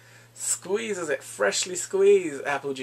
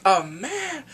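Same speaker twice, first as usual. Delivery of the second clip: mock indignant retort; 6 semitones higher, 6 dB louder than usual. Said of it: "She is pissed".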